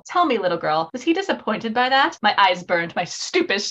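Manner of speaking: low, soft character reading; dramatic book reading. Acted. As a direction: read it low and in earnest, like a story's dialogue. Reads novel lines aloud.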